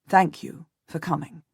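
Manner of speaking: ordinary delivery, nothing marked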